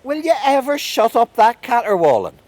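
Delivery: very high, very loud yell; irate shout. A narrator's reading. Fairy king shouts from behind a stone, irate. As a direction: bellow it at a noisy wailer. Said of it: "there came a shout from behind a big stone".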